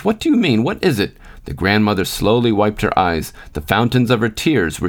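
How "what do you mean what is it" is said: high, alarmed questioning; urgent demand. A narrator's reading. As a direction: Elizabeth presses her mother-in-law for news, high and alarmed. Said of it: "interrupted Elizabeth".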